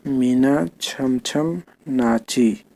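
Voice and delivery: मीना छम छम नाची said very slowly, each word drawn out; teacher reading Meena छम छम नाची slowly. Shown as a demonstration. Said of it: "ठीक है, तो ऐसे पढ़ना है".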